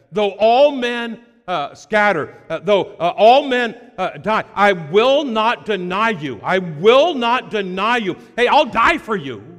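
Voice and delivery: loud, proud vow of loyalty; boastful vow. Acted. Peter swearing loud to Jesus he will never deny him. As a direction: boom it out like a brash promise.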